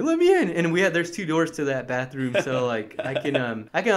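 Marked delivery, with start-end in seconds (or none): 0.0-0.5 high, urgent demand; pleading yell. Retold or imitated. Brother in the shower, pleading to get in, high yell. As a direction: bang on the door and plead. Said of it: "I'm like".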